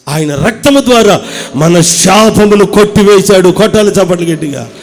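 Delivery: loud, high, fervent sermon climax; full-force preaching. Acted. Preacher drives the congregation to praise, loud and fervent. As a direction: build the crowd up to a shout of praise.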